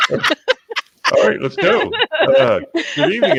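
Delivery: laughing. Real, not acted. Host opens show while laughing.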